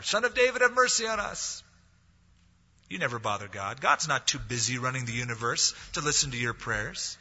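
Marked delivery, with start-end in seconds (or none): 0.0-1.6 high, pleading call for mercy; crying out louder. Acted. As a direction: cry out louder, begging. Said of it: "he cried out louder".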